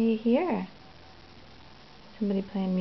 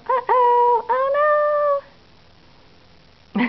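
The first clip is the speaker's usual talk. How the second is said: mock surprise; 6 dB louder, much slower than usual. Playful mock alarm at the baby.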